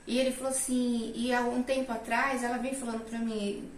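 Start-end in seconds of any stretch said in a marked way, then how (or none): none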